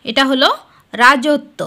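usual speaking voice

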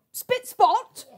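put-on British accent. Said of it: "heard a Galilean speaking British".

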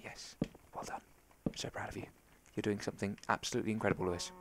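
soft hushed whisper of praise; proud viewers whispering. Retold or imitated. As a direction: whisper it so as not to jinx it. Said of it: "I'm gonna be quiet, and you guys will be like".

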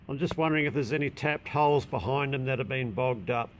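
ordinary delivery

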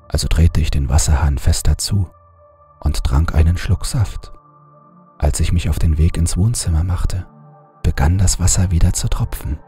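ordinary delivery

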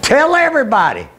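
sudden high proclamation; calling out. Retold or imitated. God commanding the preacher to spread word, calling out.

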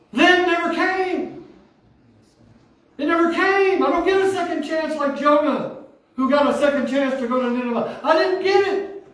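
full-force preaching of lost chances. Acted. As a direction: cry it out to the church.

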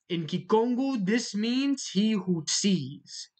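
normal talking voice

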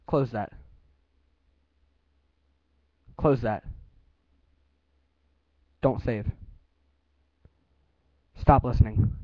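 loud, measured commands to the computer; news anchor voice. Real, not acted. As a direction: enunciate every word like a newsreader. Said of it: "use your news anchor voice".

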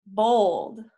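ordinary delivery, nothing marked

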